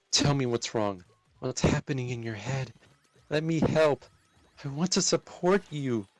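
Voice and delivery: urgent, worried pleading. Acted. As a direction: beg her to let you help. Pleading with friend to open up.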